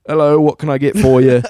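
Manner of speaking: loud, put-on British shop greeting; British accent. Clerk greeting a customer, in an amateur British accent. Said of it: "some British guy working the front counter".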